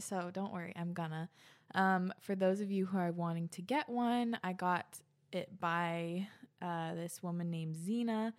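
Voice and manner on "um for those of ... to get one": usual speaking voice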